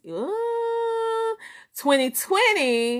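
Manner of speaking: high squealed ooh; a delighted high ooh; excited ooh. Real, not acted. Greeting a viewer with a high excited ooh.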